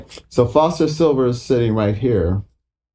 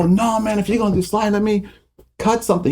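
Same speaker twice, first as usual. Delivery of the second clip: pitched way up, objecting; high pleading protest; 10 semitones higher than usual. Retold or imitated. Musician begging the producer to let him recut, pitched up. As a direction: whine your objection up high. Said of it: "I go".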